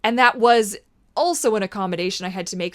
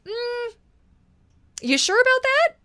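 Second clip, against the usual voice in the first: much higher, sceptical hum then challenge; skeptical comeback. Pretend. Challenging her inner critic, sceptical and much higher.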